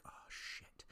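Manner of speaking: very soft whispered aside; retold hushed reaction; muttered dismay. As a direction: whisper it under your breath in dismay. Private reaction to tight time limit.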